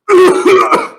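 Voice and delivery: loud, high mock choking cough; wild coughing. Game master acts out Kodaki choking on a drink, coughing. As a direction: hack and splutter as if choking. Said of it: "Kodaki is coughing wildly".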